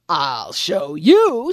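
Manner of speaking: slow menacing lion; lion threatening reflection; slow threat. Acted. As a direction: drag out the threat.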